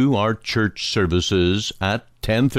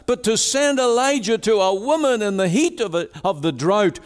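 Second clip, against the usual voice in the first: high, impassioned preacher voice; pleading sermon delivery, pitched high; preaching at full force; 12 semitones higher than usual. Acted. Preacher pleading with listeners about Elijah, impassioned.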